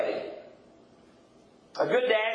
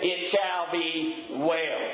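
slow, savored quote; 2 semitones lower, much slower than usual. Acted. Preacher savoring the quoted words.

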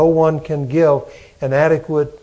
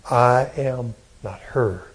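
soft, low lament, mildly sorrowful; grieving complaint; 3 semitones lower, 5 dB quieter than usual. Retold or imitated. Preacher quoting the Savior's complaint from the cross, quietly grieving. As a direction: voice a quiet, understated grief. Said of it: "This is the grief of the Savior".

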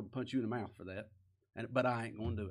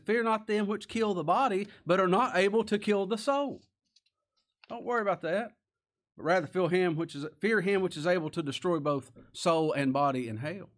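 higher and louder, proclaiming the verse; forceful scripture reading; 6 semitones higher, 6 dB louder than usual. Acted. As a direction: declaim it like a thundering preacher. Preacher quoting scripture forcefully.

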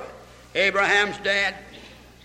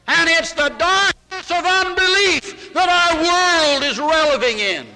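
higher and higher, rising into a preached climax; preaching at full force; 8 semitones higher than usual. Acted. Preacher warning the congregation about the darkness of unbelief, rising and preaching hard. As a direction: climb to a preacher's peak on darkness.

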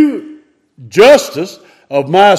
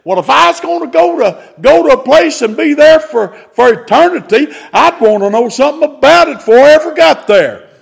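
put-on higher, folksy old-preacher voice; Brother Walker; 6 semitones higher, faster than usual. Preacher quoting old Brother Walker on hell, raised folksy voice. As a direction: imitate an old country preacher with a wry twist. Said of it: "Old Brother Walker used to say".

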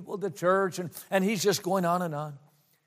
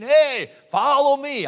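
high, loud, drawn-out hail; calling out; 8 semitones higher, 7 dB louder, slower than usual. Acted. Influencer calling out to win followers, loud high slow call. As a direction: call for attention like someone touting for followers. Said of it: "people calling out to us".

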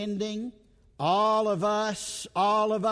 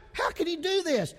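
usual speaking voice

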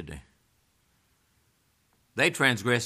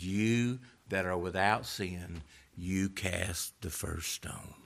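low and slow, much softer; quiet, measured quote; 4 semitones lower, 7 dB quieter, slower than usual. Retold or imitated. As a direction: deliver the rebuke slowly, low and hushed. Jesus quietly answers the accusers.

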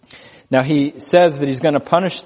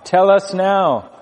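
demanding; 5 semitones higher, slower than usual. Acted. Servants pressing Jehu.

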